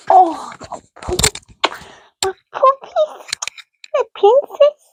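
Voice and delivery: very high cooing to a puppy; squeaky pet talk to her puppy. Real, not acted. Cooing over her dog.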